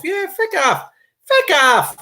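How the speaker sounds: high comic catchphrase, punched out twice; Mrs Brown. Retold or imitated. Host answering a viewer's message, high sitcom-style impression. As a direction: mimic a sitcom character's high-pitched dismissal. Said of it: "Missus Brown's boys".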